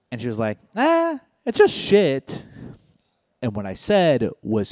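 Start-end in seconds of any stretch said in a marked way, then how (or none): 0.8-2.5 put-on much higher breezy girlfriend voice; higher mock girlfriend shrugging it off. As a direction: toss it off lightly in her voice, much higher.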